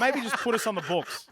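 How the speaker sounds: hoarse voice while laughing; rough laughing rasp; raspy from laughing. Real, not acted. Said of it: "I sound like a smoker 'cause I'm laughing".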